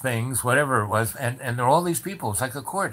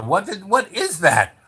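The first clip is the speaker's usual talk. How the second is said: mock outburst; 9 semitones higher, 6 dB louder than usual. Retelling his outburst to lawyer.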